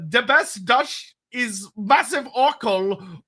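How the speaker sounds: slow put-on German accent. Shown as a demonstration. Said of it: "I did a German accent for some reason".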